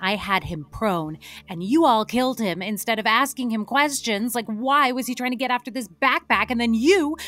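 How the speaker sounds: loud, high angry tirade; seething outburst. Acted. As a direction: let the anger build to an exasperated shout. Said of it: "she's just seething".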